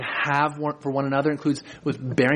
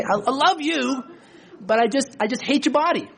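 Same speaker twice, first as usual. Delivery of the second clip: mock wife voice; 8 semitones higher than usual. Imagined wife voiced in sermon.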